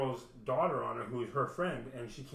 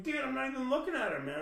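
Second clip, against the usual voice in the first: exasperated denial; 11 semitones higher than usual. Retold or imitated. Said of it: "And I'm like".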